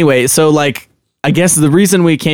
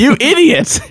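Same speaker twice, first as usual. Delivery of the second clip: mock scolding; 13 semitones higher than usual. Mock insult to cohost.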